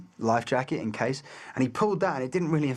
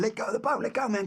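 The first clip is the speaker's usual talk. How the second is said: high-pitched urgent cry to let go; retold yell; 9 semitones higher, faster than usual. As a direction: call out in alarm, pitched high. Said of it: "And I was going".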